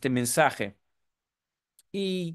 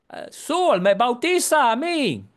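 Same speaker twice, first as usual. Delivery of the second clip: eager; 7 semitones higher, 5 dB louder than usual. Retold or imitated. Joe's surprising request.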